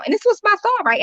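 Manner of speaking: ordinary talk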